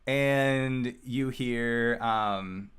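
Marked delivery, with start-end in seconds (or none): none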